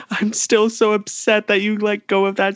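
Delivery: high-pitched wail of complaint; mock crying upset. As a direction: imitate an upset child crying through the words. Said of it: "start screaming and crying".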